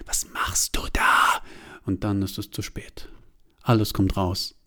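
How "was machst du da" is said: slow unvoiced annoyed murmur; annoyed whisper. A narrator's reading. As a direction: murmur it, irritated. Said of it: "Genervt raunt sie". (genervt geraunt)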